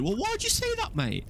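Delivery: very high-pitched put-on mate voice; Dudley impression. Retelling his mate's reply to the threat, high mock voice. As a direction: squeak it out as the challenged friend. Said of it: "He then said".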